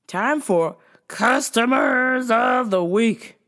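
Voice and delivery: drawn-out game show intro; segment announcer. Acted.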